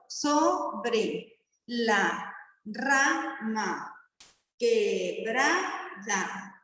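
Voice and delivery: very slow, each syllable of "sobre la rama quebrada" pulled apart; teacher slowly counting syllables for the class, very slow and spaced. Shown as a demonstration. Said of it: "¿Cuántas, cuántas métricas tiene?".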